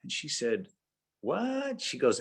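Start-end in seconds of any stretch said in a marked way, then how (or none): 1.2-1.8 a short, very high "what"; baffled what. Retold or imitated. Woman puzzled by the bank's offer, very high what. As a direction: jump up in pitch, puzzled.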